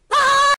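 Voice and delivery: short high-pitched scream; high "Ah!" screamed on cue; demonstrated scream. Shown as a demonstration. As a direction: let out a high scream to show your reaction. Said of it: "I was like this".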